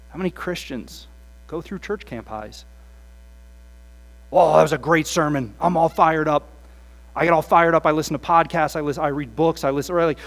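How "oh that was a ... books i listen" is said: loud gushing enthusiasm; hyped believer. Retold or imitated. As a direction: sell how fired up you are.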